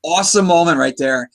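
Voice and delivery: ordinary delivery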